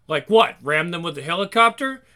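normal talking voice

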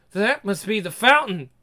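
usual speaking voice

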